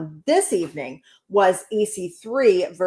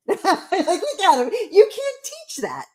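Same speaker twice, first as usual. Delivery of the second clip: high-pitched, giggly delivery; laughing; 10 semitones higher, slower than usual. Real, not acted. Wife cracking up over her husband's squirmy walk, laughing as she talks. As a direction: talk through helpless amusement.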